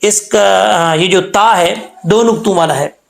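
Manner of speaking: ordinary talk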